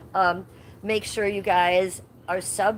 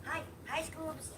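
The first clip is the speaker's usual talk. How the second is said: high, much softer pet coo; sweet hushed hi; soft pet greeting; 9 semitones higher, 16 dB quieter, slower than usual. Real, not acted. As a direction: coo at the dog.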